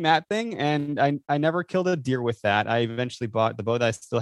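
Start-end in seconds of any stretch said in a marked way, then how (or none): none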